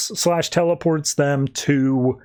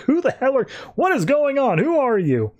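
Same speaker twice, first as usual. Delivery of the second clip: high, bewildered mock demand; alarmed retelling; 11 semitones higher than usual. Barry confronting the stranger Reverse Flash, mock alarmed. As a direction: act out startled confusion, pitched way up.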